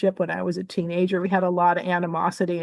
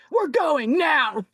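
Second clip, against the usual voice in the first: mock-angry order, higher and drawn out; imitated angry voice; 7 semitones higher, much slower than usual. Dad abruptly pulls daughter out of wake, imitated anger. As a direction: lay down a slow, high-pitched mock command. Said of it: "in this angry voice".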